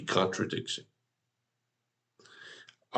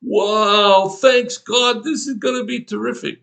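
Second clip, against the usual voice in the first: higher and louder, gushing with mock delight; mock delighted Abram; 10 semitones higher, 6 dB louder, slower than usual. Preacher voicing grateful Abram to God, hammed-up excited. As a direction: gush like a thrilled Abram, hammed up. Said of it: "an occasion for Abram to say".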